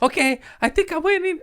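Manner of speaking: put-on very high, fast kid voice with a laugh; squeaky laughing kid; little kid voice. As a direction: chirp it like a toddler. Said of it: "he's like".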